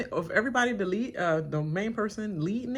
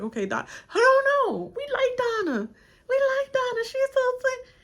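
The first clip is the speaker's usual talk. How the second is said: very high, loud sugary falsetto; falsetto group voice; 7 dB louder than usual. Retold or imitated.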